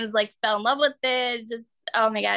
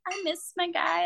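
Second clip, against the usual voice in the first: imitated tearful softness, slightly high; retold teary voice; 7 semitones higher, 4 dB quieter than usual. Artist retells costar tearing up at the portrait, mild teary voice. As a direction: play him a little choked up, higher. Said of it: "tearing up a bit".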